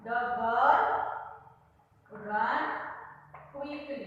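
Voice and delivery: very slow, with long gaps between words; board-writing dictation. Shown as a demonstration. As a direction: dictate each word as you write it.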